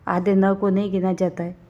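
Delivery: ordinary talk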